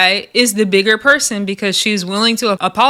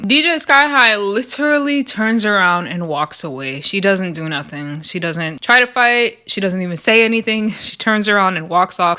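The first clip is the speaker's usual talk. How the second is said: ordinary talk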